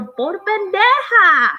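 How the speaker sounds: high, stretched-out mock holler; slow, high-pitched mock telling-off; scolding parent. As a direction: imitate a parent yelling at a clumsy kid. Mimics parents scolding hurt child.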